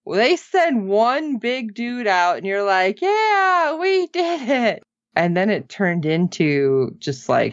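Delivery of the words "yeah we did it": high, drawn-out mock victory cheer; slow, high pretend hurrah. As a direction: celebrate like overconfident rebels, hammed up. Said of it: "Why are you guys cheering?".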